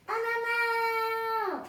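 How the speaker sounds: put-on a child hamming up a scream for the story; pretend scream. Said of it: "I'm gonna scream".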